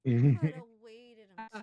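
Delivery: loud, slow protest; a hot-tempered woman yelling back at her friend; indignant shout. Acted. Genasi argues loudly with drow.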